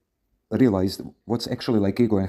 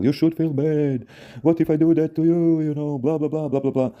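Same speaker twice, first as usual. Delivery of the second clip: put-on scolding angry boyfriend; 8 semitones higher than usual.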